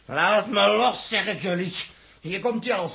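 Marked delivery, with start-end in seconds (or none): 0.0-1.7 slow, louder, forceful demand; angry struggle. Acted. Dirk fights off the crew holding him, slow and angry. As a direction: wrench free and insist.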